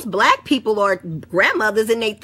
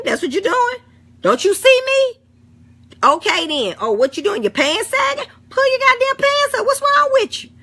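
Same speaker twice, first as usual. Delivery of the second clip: much higher mock-scold of a youngster; scolding elder; 13 semitones higher than usual. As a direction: play the outraged auntie catching a kid cussing. Mock scolding of sagging-pants kids.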